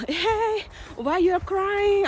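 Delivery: slow, high, caring question to a crying stranger; gently asking in English. Retold or imitated. Asking a crying woman on the train what's wrong, slow and high. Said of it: "Aku bilang".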